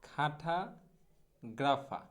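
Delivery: খাঠাগ্রাফা very slowly, split "kha-tha ... gra-fa"; broken-down pronunciation. Shown as a demonstration. Teacher modelling cartographer slowly, খাঠাগ্রাফা as "kha-tha, gra-fa". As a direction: draw out খাঠাগ্রাফা in two pieces, no r at the end. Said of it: "এইরকম".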